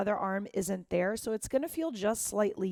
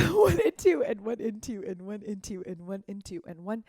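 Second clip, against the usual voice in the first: laughing count; 4 dB louder than usual. Real, not acted. Teacher laughs after joking about needing breath, laughing count. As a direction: count through a laugh, louder.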